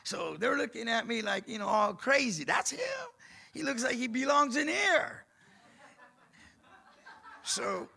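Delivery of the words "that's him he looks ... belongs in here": much higher, jeering guard voice; mocking guards. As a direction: sneer it like a guard who knows the type.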